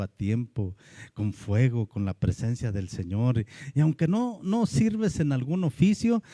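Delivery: ordinary talk, nothing marked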